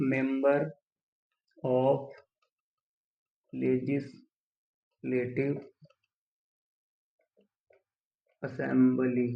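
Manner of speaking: very slow, long gaps between words; dictating while writing. Shown as a demonstration. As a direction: break it into pieces for note-takers. Writing the term out while saying it. (लिखते हुए बहुत धीरे, रुक-रुक कर)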